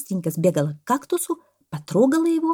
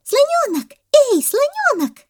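very high, loud monkey shout; gleeful calling out; 8 dB louder, slower than usual. A narrator's reading. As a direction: call a friend over in a squeaky yell. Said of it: "обрадовалась мартышка и закричала". (радостно зовёт, кричит)

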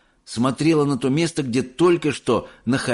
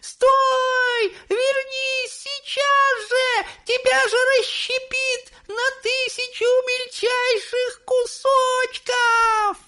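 mother shrieking; much slower than usual. A narrator's reading. Mrs Teavee shrieking at her son to stop. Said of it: "завизжала миссис Телек".

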